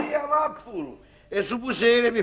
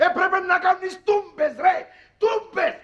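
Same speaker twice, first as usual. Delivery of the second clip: mock scolding shout; 7 semitones higher, faster than usual. Friend scolding the father.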